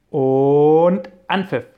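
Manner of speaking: loud, slow, drawn-out kickoff call; match whistle call. Acted. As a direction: call the kickoff like a referee, stretching it out. Professor starts the robot match.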